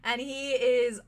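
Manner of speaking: ordinary delivery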